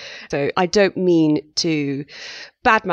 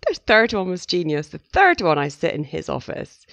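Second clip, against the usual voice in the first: normal talking voice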